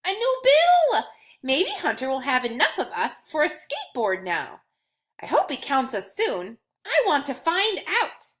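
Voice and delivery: a tiny coin bursting with hope; excited coin Scratch. Acted. Coin voiced in children's story.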